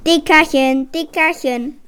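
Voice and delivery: teasing call, loud on the first word; cheeky creature's taunt; piercing call. A narrator's reading. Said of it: "rief die gleiche durchdringende Stimme".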